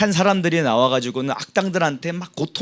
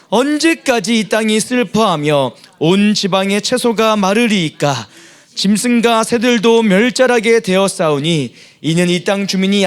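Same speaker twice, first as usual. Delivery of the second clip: loud, raised recitation of scripture; scripture reading; 4 semitones higher, 4 dB louder than usual. Acted. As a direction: read the verses out with force. Congregation reads scripture aloud. (힘 있게 성경 낭독)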